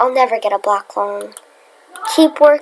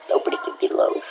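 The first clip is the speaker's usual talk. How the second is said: whispering; 7 dB quieter, much faster than usual. Pretend. Whispered complaint.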